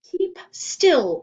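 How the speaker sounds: loud, high barked command; sharp order. A narrator's reading. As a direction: order him to freeze. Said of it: "ordered Missus Jules".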